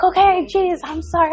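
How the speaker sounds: high-pitched mock apology; chastened son, imitated. Son apologizing to his cussing mom, mock defensive. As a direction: back down like a scolded kid, voice up. Scolded son backs down, retold.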